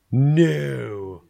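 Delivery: loud high flat refusal; emphatic no. Retold or imitated. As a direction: shut the idea down hard. Brother-in-law refusing, retold.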